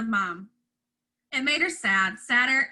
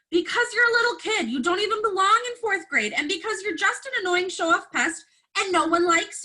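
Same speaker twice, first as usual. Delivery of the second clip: put-on angry boy; 7 semitones higher than usual. A narrator's reading. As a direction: spit the insults out at her. Evan's angry outburst at sister.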